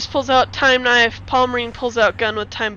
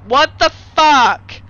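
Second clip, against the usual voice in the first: ordinary talk, nothing marked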